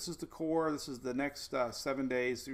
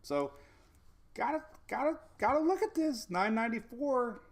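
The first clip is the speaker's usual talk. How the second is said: higher pitched and rising with excitement; excited; 7 semitones higher than usual. Real, not acted. Forecaster thrilled by the Euro's pressure readings, higher and excited. Model shows a very deep storm.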